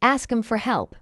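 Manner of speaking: quick, linked "Ask 'em for help". Shown as a demonstration.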